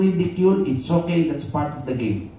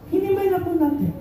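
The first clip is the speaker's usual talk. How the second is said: much higher, insistent repeat of "Hinamay na po natin"; emphatic repeat; 13 semitones higher, faster than usual. Real, not acted. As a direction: hammer home "Hinamay" up high with insistence. Repeats summary line, much higher. (mataas at mariing ulit ng "Hinamay na po natin")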